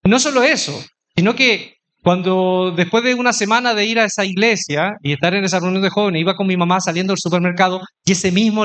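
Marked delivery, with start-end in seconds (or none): none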